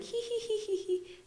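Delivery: put-on high little-girl giggle "hee hee"; squeaky laugh. Shown as a demonstration. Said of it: "to laugh with a high-pitched, uh, voice, like a little girl".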